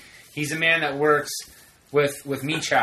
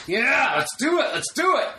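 retold eager cheer; 10 semitones higher than usual. Urging security to tow the car, imitated excitement. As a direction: egg them on, pitch climbing.

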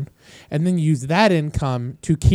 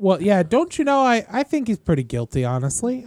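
put-on half-hearted Wisconsin accent, raised pitch; a light try at a Wisconsin accent; 5 semitones higher than usual. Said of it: "Answer in your best Wisconsin accent".

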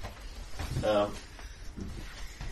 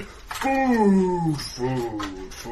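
other creature's voice; 4 dB louder, much slower than usual. Acted. GM demonstrates the creature that only says food, drawn-out chant. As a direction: chant it like a single-minded monster. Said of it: "the other thing is just going".

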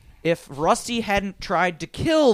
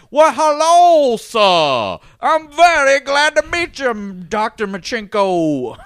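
big, loud, high Southern greeting, drawn out slowly; hammed-up Southern accent; 8 semitones higher, 10 dB louder, slower than usual. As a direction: boom a mock Southern welcome, stretching the vowels. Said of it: "The mayor had a Southern accent".

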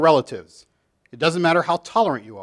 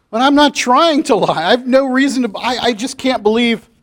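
higher, louder, faltering plea; a cornered teacher begging them to listen; pleading teacher; 7 semitones higher, 4 dB louder than usual. Acted. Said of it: "the children".

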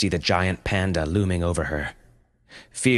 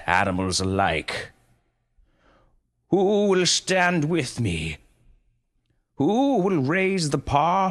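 rousing speech; 7 semitones higher than usual. A narrator's reading. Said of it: "he yelled".

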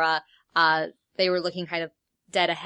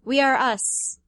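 put-on higher eerie voice of possessed children; 7 semitones higher, 3 dB louder than usual. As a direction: speak as one eerie voice.

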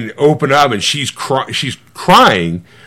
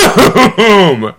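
big drawn-out mock explosion, higher and louder; explosive boom; 7 semitones higher, 5 dB louder than usual. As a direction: boom it out with a laugh, imitating a burst of tears. Imitating sudden burst of tears.

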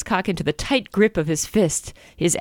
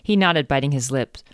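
ordinary delivery

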